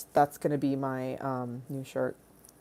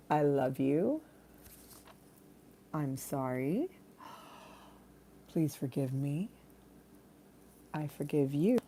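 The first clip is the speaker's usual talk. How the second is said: much softer, slow mantra; soft prayer; 3 semitones lower, 12 dB quieter than usual. Acted. Streamer reciting the Ho'oponopono mantra, soft and slow. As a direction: murmur the healing phrases gently.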